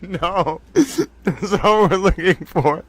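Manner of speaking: slow words broken by laughing; laughing to tears. Real, not acted. Host finds the definition, laughing through it. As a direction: talk through the giggles. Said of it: "I'm crying. Te-, towel tears of laughter".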